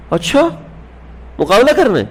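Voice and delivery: high, lightly amused "so, you want a match?"; amused surprise. Retold or imitated. Retelling the Prophet answering the wrestler's challenge, amused surprise. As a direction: raise the pitch in mock surprise at the challenge.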